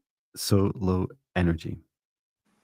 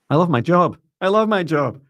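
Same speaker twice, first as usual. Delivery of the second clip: happy singsong; 12 semitones higher, 6 dB louder than usual. Retold or imitated. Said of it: "I did catch myself this morning just saying".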